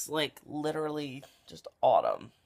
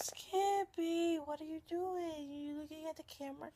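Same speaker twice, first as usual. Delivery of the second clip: cooing pet talk; 14 semitones higher, 7 dB quieter than usual. Real, not acted. Owner asking Skippy about the camera, high cooing baby talk. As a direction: coo softly at a cute dog, way up high.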